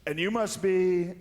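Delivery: high, slow mock Trump voice; Trump impression. Comic imitating the president greeting a team, slow and high. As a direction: drawl it out like Trump greeting athletes. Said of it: "he's like".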